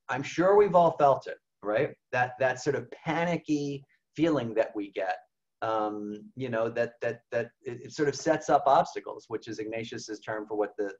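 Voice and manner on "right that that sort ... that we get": normal talking voice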